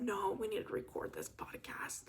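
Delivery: soft, breathy whisper to herself; retold whisper. Whispering to herself to record the podcast. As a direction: hush yourself into getting to work. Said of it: "but I said".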